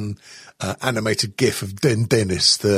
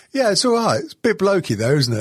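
station manager voice; 8 semitones higher than usual. Retold or imitated. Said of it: "she went".

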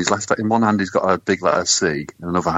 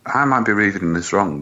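ordinary talk, nothing marked

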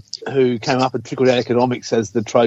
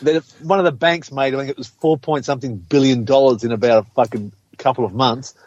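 ordinary talk, nothing marked

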